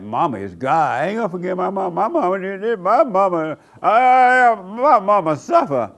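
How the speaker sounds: high, loud mock protest building to a wail; indignant mama's defender. Preacher mimicking people who won't forgive mama, in mock-indignant protest.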